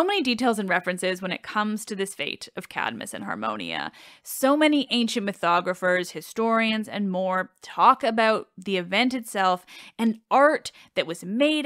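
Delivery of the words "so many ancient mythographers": ordinary delivery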